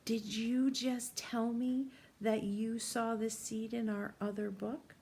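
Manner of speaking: ordinary delivery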